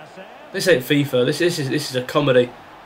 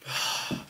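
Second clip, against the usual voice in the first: soft, short exhale; weary sigh; 13 dB quieter than usual. Real, not acted. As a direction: let out a quiet sigh. Fed up with the match.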